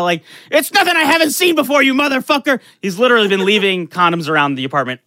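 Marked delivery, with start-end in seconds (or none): 0.5-2.6 high, loud comeback; retold angry yell. Student yelling back at the naked roommate, retold fury. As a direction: yell it back, outraged, pitched way up. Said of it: "And I yell".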